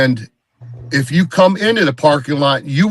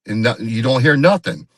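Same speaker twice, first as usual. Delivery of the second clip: ordinary talk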